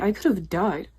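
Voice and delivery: ordinary talk, nothing marked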